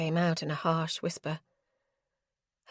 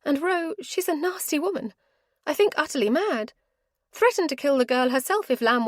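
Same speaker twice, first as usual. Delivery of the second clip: lightly higher female character voice; young woman's voice; 8 semitones higher than usual. Acted. Heroine telling Ro about the kidnapper, worried. Caroline's voice in audiobook.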